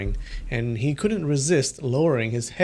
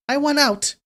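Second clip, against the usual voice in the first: wailing demand; 12 semitones higher than usual. Acted. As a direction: escalate to a high wail. Repeat rises to wail.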